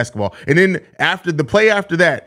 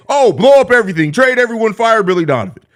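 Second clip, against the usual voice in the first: much higher and louder, hammed-up fan outcry; mock panicking fan; 7 semitones higher, 5 dB louder than usual. Streamer parodies Bulls fans' default demands, loud mock panic. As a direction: ham up a fan screaming to blow it all up. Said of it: "default responses from the Bulls fans".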